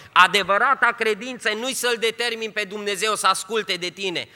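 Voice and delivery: loud, high, fervent sermon voice; preaching at full force. Acted. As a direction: proclaim it with full fire. (predică în forță, tare și înalt)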